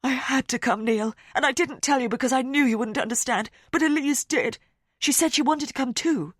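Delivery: a touch of tearful desperation. Acted. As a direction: explain through tears. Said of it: "tears rolling down her face".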